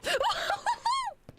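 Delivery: very high, loud wavering cry; high startled whoop. Real, not acted. Player startled by the game, high loud whooping cry.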